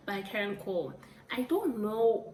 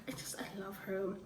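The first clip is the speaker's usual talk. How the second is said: breathy whisper, no voice at all; whispered gush; 8 dB quieter than usual. Real, not acted. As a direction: whisper it like a secret crush. Gushing about [name].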